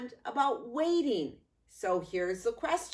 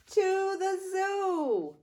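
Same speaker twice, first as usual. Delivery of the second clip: excited reveal; 9 semitones higher, slower than usual. Acted. Answering her own question to kids.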